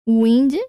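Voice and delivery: teacher modelling Windsurf for the class; emphatic demo pronunciation. Shown as a demonstration. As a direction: say Windsurf loudly so the U glide is clear. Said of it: "Então, ficaria assim, ó".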